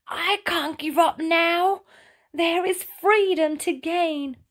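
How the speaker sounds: determined effort. Acted. Ray wriggles free.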